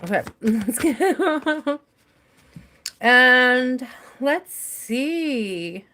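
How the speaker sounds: laughing. Real, not acted. Laughing at herself over candy.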